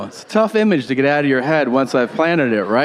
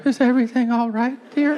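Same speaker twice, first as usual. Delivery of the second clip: high, quick, timid mock wife voice; poor mother; 10 semitones higher than usual. Mother to sleepless dad in bed, high wifely voice. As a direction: ask meekly in a high put-on voice. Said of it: "And your poor mother".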